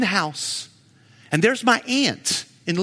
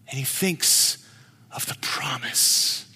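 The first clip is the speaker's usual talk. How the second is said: whispered; 6 semitones lower, 5 dB quieter, slower than usual. Acted. Whispered sermon climax.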